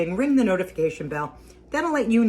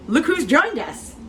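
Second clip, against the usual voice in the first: teasing announcement; 10 semitones higher, 6 dB louder, slower than usual. Real, not acted. Teasing husband joining on camera.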